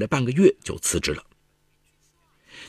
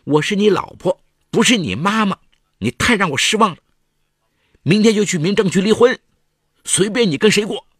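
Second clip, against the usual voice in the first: high, very loud furious yell; 11 semitones higher, 7 dB louder than usual. A narrator's reading. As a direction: shout it in despair and rage. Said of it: "他吼道".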